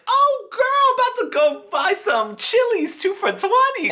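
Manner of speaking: very high, loud falsetto squeal; sky-high falsetto, hammed-up glee; squealing excited. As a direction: shriek it like a giddy girl planning a treat. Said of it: "And I'm like".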